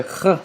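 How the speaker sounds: Kh scraped from the throat; throaty Kh. Shown as a demonstration. Teacher sounding out letter Kha, throaty Kh. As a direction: rasp the Kh at the back of the throat. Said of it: "sound from the throat".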